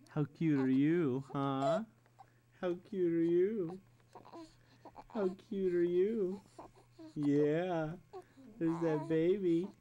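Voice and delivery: normal talking voice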